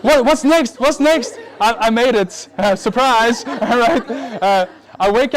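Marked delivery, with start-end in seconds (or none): 0.0-1.4 high, loud mock suspense; mimicked anxious audience. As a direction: imitate listeners hanging on the story. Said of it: "you guys were so serious".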